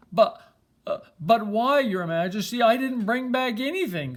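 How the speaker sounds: astonished prince; surprised. Acted. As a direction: stammer in disbelief. Bernard surprised at winning.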